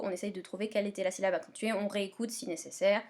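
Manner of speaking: normal talking voice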